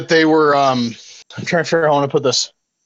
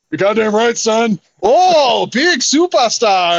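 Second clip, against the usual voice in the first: hammed-up Stone Cold boast; Steve Austin impression; 7 semitones higher, slower than usual. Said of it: "I did my Steve Austin impression".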